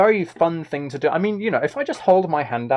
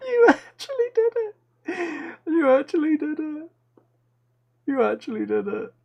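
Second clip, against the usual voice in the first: excited squeal; 13 semitones higher than usual. Real, not acted. Excited reaction to membership.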